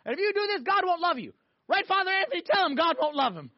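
high-pitched imitation of a ranting parent; hammed-up yelling at a kid; mock parental yelling. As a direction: nag and scold way up high. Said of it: "a parent yelling at her kid".

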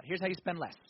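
ordinary talk, nothing marked

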